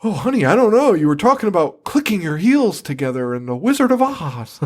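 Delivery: mock the mom sweetly condescending. Mom teasing fainted daughter, high sing-song voice. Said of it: "your mom's going".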